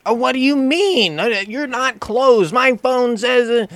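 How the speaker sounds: mock high whiny customer. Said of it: "arguing with me over the speaker".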